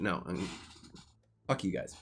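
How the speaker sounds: ordinary talk, nothing marked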